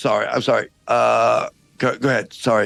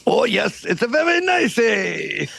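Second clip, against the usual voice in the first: put-on French accent. French guard calling down from the castle wall, hammed-up French accent. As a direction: ham up a silly French guard. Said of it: "this outrageous accent".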